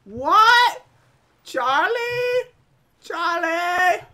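loud, high, questioning shouts; play-acted startled calling; high-pitched calling. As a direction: call out loudly with pitch jumping up. Echoes the game's calling voice.

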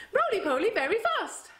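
fast high roly poly. Shown as a demonstration.

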